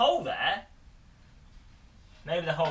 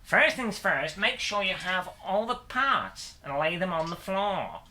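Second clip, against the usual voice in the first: peppy American presenter intro; American accent, hammed-up tutorial host delivery; put-on American accent; 2 semitones higher than usual. Builder presents the cockpit build to viewers, American accent. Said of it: "best American accent I could do".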